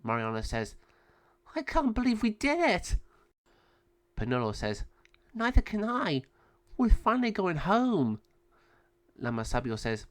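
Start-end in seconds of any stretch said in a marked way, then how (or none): 1.5-3.0 put-on high, light child voice; a lightly voiced happy girl; young bird girl. Mariana rejoices with Penelo, high girlish voice. 5.3-8.2 high, light bird voice; lightly voiced little bird; young bird boy. Penelo answers Mariana, high light voice.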